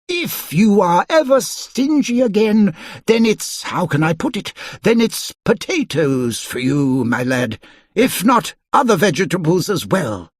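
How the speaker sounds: high, stern warning; stern wizard. A narrator's reading. Wizard warning Mister Stingy, stern. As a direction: warn the lad like a fussy wizard. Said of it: "he added sternly".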